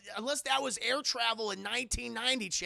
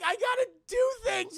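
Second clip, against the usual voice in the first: high, louder put-on protest; mock protesting woman; 14 semitones higher, 7 dB louder than usual. Woman objecting to being thrown out, high mock protest. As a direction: plead it in a raised voice. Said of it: "But she's like".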